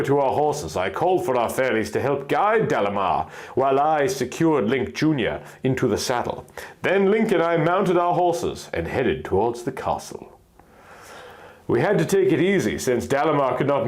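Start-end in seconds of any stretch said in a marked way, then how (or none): none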